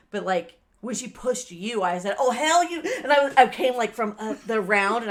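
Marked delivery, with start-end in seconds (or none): 2.2-2.8 higher, louder retold outburst; indignant outburst. Telling how she confronted the woman who pushed her, indignant. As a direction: burst out with mock outrage. Retelling her retort before pushing.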